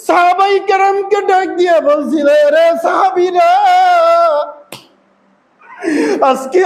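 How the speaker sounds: high, full-voiced wailing chant; high-pitched preacher's lament, near crying; anguished sermon cry. A narrator's reading. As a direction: call out in grief with stretched endings.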